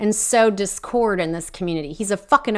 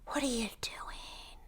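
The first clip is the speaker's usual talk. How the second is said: whispered, slow and softer; mock whisper; 2 semitones higher, 20 dB quieter, slower than usual. Podcaster mimicking Paula's quiet question, slow whisper. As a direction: hiss the question under your breath in a mock whisper. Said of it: "So she talks".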